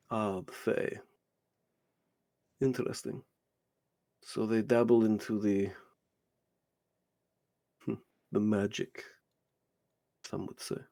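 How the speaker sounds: soft, musing villain with long pauses; hushed, halting appraisal; intrigued menacing stranger. Acted. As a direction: muse quietly, weighing each phrase. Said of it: "and he goes".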